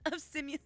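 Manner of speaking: high cackling laugh into the mic; can't stop cackling; shrill helpless laughter; real cackle. Real, not acted. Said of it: "so hard not to scream, cackle".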